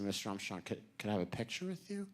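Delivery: soft, halting fan's question; timid request. Retold or imitated. Young fan to his astronaut hero, timidly asking. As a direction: ask meekly, stumbling on the start.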